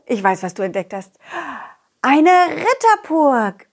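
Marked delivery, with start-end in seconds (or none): 2.0-3.7 amazed. Acted. Discovers a castle.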